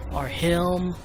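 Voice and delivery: ordinary talk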